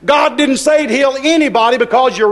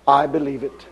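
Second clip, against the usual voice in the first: quiet conviction; 8 semitones lower, 5 dB quieter than usual. Real, not acted.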